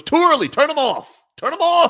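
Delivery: squeaky high cheapskate owner voice; mock stingy owner. Mocking owner Bruce Sherman ordering lights off, squeaky high. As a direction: pitch way up, nagging to kill the lights.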